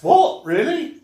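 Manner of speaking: loud, high disbelieving what; shocked, really?; surprised. Real, not acted. Shocked by the trivia about the actor.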